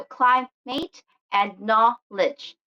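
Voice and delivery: "climate and knowledge", slowly, stressing CLI and KNOW; slow teaching demo, leaning on starts of "climate and knowledge"; stress on first syllables. Shown as a demonstration. Teacher repeats noun examples for learners, slow CLI-mate and KNOW-ledge in "climate and knowledge". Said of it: "The stress is in the beginning".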